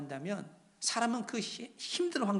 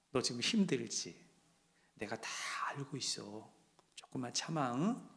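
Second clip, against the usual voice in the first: very soft, low, near-whispered reassurance; tender hushed comfort; 6 semitones lower, 9 dB quieter than usual. Acted. God taps a weary believer on the shoulder, tender and hushed.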